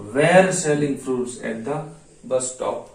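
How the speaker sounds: very slow, word-by-word English dictation. Shown as a demonstration. Teacher dictating a question to Gujarati students, very slow and spaced. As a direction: spell it out for students to write.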